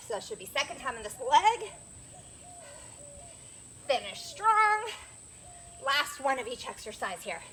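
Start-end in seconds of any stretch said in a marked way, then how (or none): 3.9-4.8 encouraging shout. Real, not acted. Coach pushing final set.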